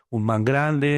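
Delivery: usual speaking voice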